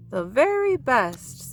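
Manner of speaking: Daddy Bear beaming at his cub; smiling. Acted. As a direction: savour the praise with a smile. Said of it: "smiled Daddy Bear".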